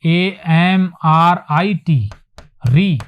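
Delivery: letters A M R I T very slowly; spelled slowly. Shown as a demonstration. Teacher spelling AMRIT in English, slowly. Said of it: "अंग्रेजी में लिखेंगे तो".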